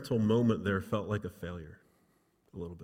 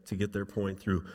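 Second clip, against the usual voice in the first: ordinary talk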